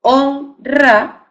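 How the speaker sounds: honra said high, on and ra separated; teacher splitting honra into syllables; syllable split honra. Shown as a demonstration. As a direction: break honra into on and ra. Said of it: "primera sílaba on".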